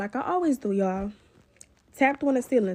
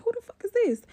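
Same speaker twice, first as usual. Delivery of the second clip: startled; 11 semitones higher than usual. Retold or imitated. Sees a stranger at the door.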